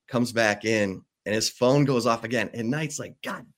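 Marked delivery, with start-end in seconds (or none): 3.2-3.5 exasperated curse. Retold or imitated. Knight's third outburst at phone.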